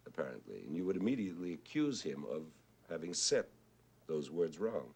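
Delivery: usual speaking voice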